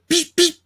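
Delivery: high, hissy "psh" shots; two short mouth-made puffs; imitated movie gunshots. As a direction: mimic silenced pistol fire. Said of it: "movies are just like".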